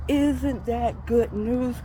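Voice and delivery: drawn-out, savored rhetorical question; slow emphatic question. Real, not acted. Preacher pressing the good news on listeners, slow and emphatic. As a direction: stretch ISN'T THAT GOOD NEWS slowly, word by word.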